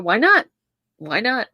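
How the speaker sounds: usual speaking voice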